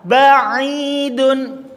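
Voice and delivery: Ba'idun chanted high and drawn out with clear lips; model word. Shown as a demonstration. Teacher models Ba'idun for the class. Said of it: "Ketika di fathah".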